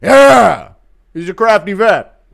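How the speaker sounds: loud, high hype-man impersonation; Lucas. Acted. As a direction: shout approval like an excited coach. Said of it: "Lucas catching McGregor and trying to fix his form".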